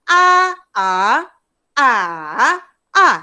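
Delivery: A level, A rising, A dipping, A falling; four A's, each with its own tone; four tones in turn. Shown as a demonstration. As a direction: run A through all four Mandarin tones, loudly. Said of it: "empat-empat tones tu".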